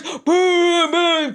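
mimicking thunder for the kids; booming thunder. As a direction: boom out like a storm. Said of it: "I was booming in between my words".

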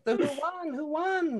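high, urgent repeated question; can't wait to hear the winner; eager asking. Real, not acted. Streamer eagerly asking players who won, high pitched.

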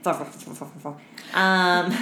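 ordinary talk, nothing marked

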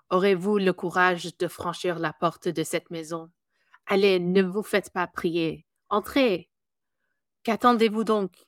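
lightly spooky ghost host in French; a hint of a sinister mansion host; Vincent Price voice. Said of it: "can you give us a little bit from the ride".

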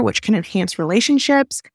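normal talking voice

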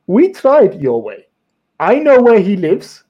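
slow, menacing Eastern European accent, imitated; thick Eastern European accent. Retelling a client's phone threat in Eastern European accent. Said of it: "in this really thick Eastern European accent that I'm now gonna attempt to imitate".

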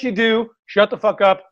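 normal talking voice

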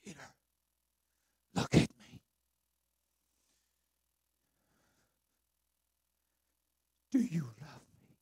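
soft whispered question; whispered plea. Acted. Jesus asks a third time.

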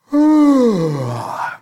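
long, high, unimpressed sigh; bored sigh. Retold or imitated. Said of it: "on the other end goes".

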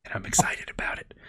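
unvoiced whispered aside; whispering. Real, not acted. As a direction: whisper it under your breath.

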